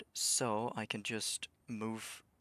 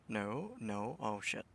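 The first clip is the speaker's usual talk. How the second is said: ordinary talk, nothing marked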